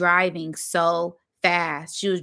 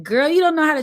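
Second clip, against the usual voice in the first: pitched up high and louder, exasperated; incredulous shout; 9 semitones higher, 6 dB louder, much faster than usual. Retold or imitated. Narrator to her friend, a loud incredulous shout. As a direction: throw it back at her in disbelief.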